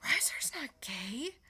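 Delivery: breathy whisper of disbelief; whispered shock. Retold or imitated. Reader stunned by a twist, whispering. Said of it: "And I was like".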